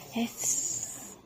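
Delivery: whispered hiss, no voice; snake hiss. Shown as a demonstration. Mother demonstrates snake sound.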